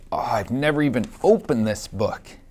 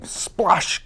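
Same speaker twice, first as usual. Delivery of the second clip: splosh; 6 semitones higher than usual. A narrator's reading. Elephant splash in book.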